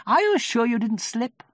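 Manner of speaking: high, loud knowing question; wise. A narrator's reading. Rabbit questioning Eeyore, wisely.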